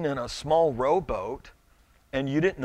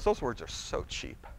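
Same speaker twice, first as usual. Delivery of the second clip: wife's quiet rebuke; 4 semitones lower, 9 dB quieter than usual. Retold or imitated. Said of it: "She goes".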